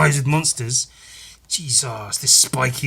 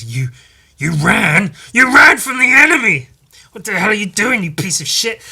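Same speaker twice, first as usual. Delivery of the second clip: 1 angry friend; 8 semitones higher, 8 dB louder than usual. Acted. Voicing angry eyeball friend.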